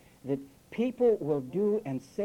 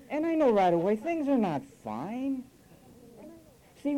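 usual speaking voice

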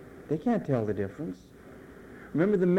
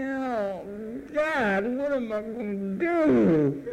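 mock panic; 4 semitones higher, slower than usual. Mock panicked believer facing Satan.

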